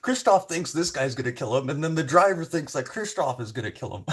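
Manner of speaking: laughing. Real, not acted.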